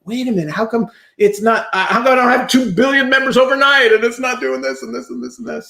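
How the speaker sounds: hammed-up frustrated whine; incredulous self-quote. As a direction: mimic your impatient younger self, baffled. Retelling his own impatient launch.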